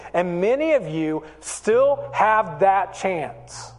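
rising, urgent preaching; preaching at full force. Real, not acted. Pastor urging the congregation to forgive, fervent preaching. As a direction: press the crowd toward forgiveness.

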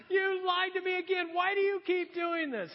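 high, hammed-up whine of accusation; mock wailing complaint. Delilah scolding Samson for lying, mock wailing. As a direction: bewail being lied to, overacting.

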